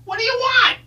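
high squeaky Stooges voice; Curly voice. Acted. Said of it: "high-pitched voice that sounds a little bit like Curly from the Three Stooges".

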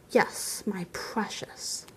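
slow, hushed, whispered possessiveness; gloating whisper. A narrator's reading. Bilbo croons over the ring to himself, whispering. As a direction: whisper it slowly, as to the treasure.

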